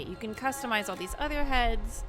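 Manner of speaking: ordinary talk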